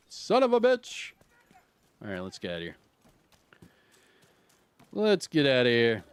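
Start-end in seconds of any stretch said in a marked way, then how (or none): 0.1-1.1 a sudden much higher cry of annoyance; frustrated curse. Real, not acted. Gamer finding the chest already looted, cursing in frustration. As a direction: blurt it out, stung by bad luck.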